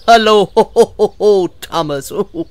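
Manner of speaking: booming ho-ho laugh through the greeting; loud belly laugh breaking up the words; guffawing. Acted. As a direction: guffaw heartily as you say hello. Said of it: "he guffawed".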